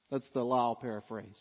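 ordinary talk, nothing marked

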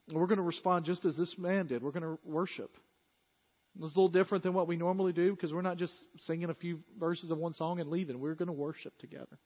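rally the room toward worship; fervent preaching. Acted. Pastor calling the church to worship, fervent preaching.

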